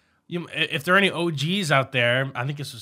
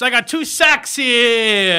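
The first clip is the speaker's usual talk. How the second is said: playful announcer; 9 semitones higher, 8 dB louder, much slower than usual. Acted. Show intro teasing game.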